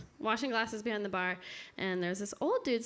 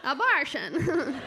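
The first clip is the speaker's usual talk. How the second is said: slow, higher and louder, hammed-up silly voice; 4 semitones higher, 5 dB louder, much slower than usual. Shown as a demonstration. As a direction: stretch the word abortion out slowly in a goofy, laughing voice. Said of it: "if you say it in a silly voice".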